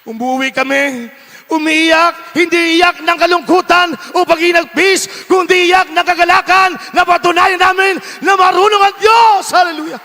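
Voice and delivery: high, loud, slow fervent preaching; preaching at full force. Real, not acted. Pastor exhorting his congregation, full-force preaching. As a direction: hammer each word home to the flock.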